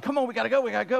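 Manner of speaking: high, urgent hurry-up call; rushing a late friend out the door; anxious hurry. Retold or imitated. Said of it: "more and more anxious".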